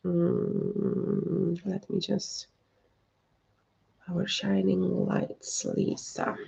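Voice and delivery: hushed, inward murmur before the reading; hushed tuning in. Real, not acted. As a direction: drop to a near whisper as if listening inward.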